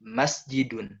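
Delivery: MAS-jidun, falling after the first syllable; stress on first syllable. Shown as a demonstration. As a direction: press on "mas" in masjidun. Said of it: "kita harus tekan di huruf pertamanya".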